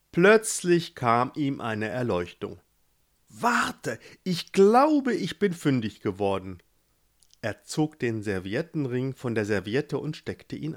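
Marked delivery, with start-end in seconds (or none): 3.3-6.6 lightly voiced young prince, higher, a sudden idea; a hint of a clever brother who has found it; eager Gerhard. Gerhard finds the ring. (leicht gespielter Gerhard, höher, plötzliche Eingebung)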